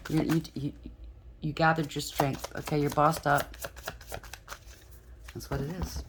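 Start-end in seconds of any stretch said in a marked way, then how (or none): none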